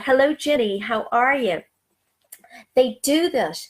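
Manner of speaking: ordinary talk, nothing marked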